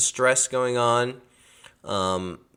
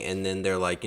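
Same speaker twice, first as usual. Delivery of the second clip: ordinary delivery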